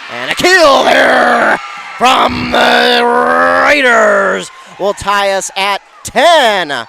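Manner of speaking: drawn-out, high, excited sportscaster shout; roaring point call. Real, not acted. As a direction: shout it out, stretching the big words. Commentator calls the tying point.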